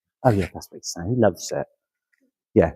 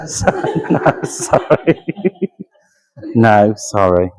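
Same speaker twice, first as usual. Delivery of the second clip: giggly apologies through laughter; breathless giggling sorry; laughing; 5 dB louder, much slower than usual. Real, not acted. Medium laughs at baby remark.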